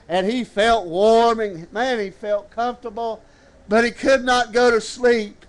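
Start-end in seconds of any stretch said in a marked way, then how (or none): none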